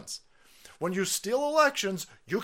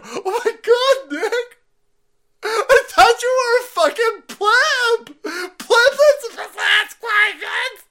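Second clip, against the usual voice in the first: very high, loud, shrieking mock sobs; mock panicked woman; 10 dB louder, slower than usual. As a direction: ham up a hysterical woman about to be fired. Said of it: "this chick knows she's gonna get laced into".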